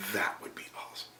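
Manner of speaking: breathy hushed whisper. Retold or imitated. Clerk answering the job offer in a hushed whisper. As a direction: let it out as a secret, barely voiced.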